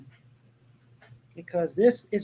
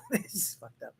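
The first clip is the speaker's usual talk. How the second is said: laughing; 5 semitones higher, 15 dB quieter than usual. Real, not acted. Laughs reading parody article.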